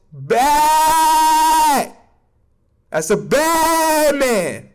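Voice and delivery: loud high shout then slow drawn-out praise; hype praise. Real, not acted. Cheering for his favourite player, loud and drawn out. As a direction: hype him up like a fan.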